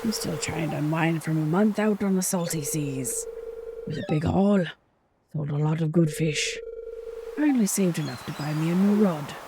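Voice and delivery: put-on old fisherman chatting up the newcomer, hearty. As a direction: play a jolly weathered fisherman. Voicing Willy the fisherman.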